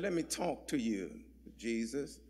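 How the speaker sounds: low, hushed private word; confidential aside. Retold or imitated. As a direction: imitate pulling someone quietly aside. Peter takes Jesus aside privately.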